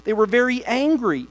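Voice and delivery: steady, measured Bible reading to the church; congregation-facing scripture reading. Acted. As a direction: read the passage aloud with weight. Reads long Nehemiah passages.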